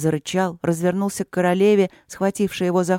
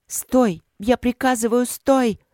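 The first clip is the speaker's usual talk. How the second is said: higher, louder royal order; commanding shout; 6 semitones higher, 3 dB louder, slower than usual. Acted. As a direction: stop the beast with a shouted command. Queen commands fire spirit.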